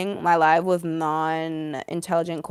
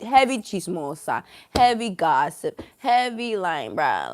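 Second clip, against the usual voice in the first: normal talking voice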